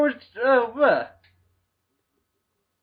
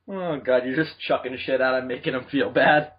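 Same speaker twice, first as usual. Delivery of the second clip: ordinary delivery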